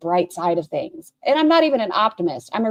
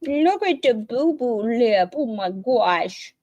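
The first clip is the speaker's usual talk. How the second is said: slow, doting mock baby talk; 2 semitones higher, much slower than usual. Host teases the streamer's pout in slow mock baby talk. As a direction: coo at her pouting lip. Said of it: "a baby before they start crying".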